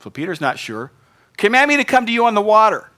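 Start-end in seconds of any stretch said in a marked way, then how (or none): 1.4-2.9 high, loud and fast plea; Peter calling out. Acted. Peter calling to Jesus from the boat, loud and high. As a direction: shout it across the waves.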